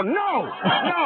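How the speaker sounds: high, hasty no no; flustered denial. Retold or imitated. Howie denying who he is to a passing driver. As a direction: deny it quickly, mock-flustered. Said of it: "I go".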